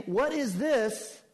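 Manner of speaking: high, slow astonished question; amazed crowd again. Acted. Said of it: "Let me do that to you again".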